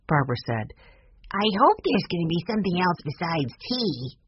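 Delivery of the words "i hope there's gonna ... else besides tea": put-on little-boy voice, Benny's part; child's voice. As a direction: speak up like a small hungry kid. Young Benny voiced in narration.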